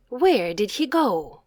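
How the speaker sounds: usual speaking voice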